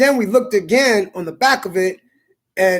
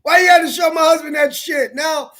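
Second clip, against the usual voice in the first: angry shout; 7 semitones higher than usual. Retold or imitated. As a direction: yell the blame at him. Wife blames the narrator.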